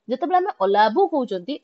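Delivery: usual speaking voice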